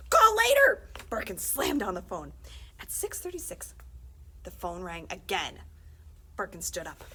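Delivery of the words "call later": loud. Acted. Principal shouts down the phone.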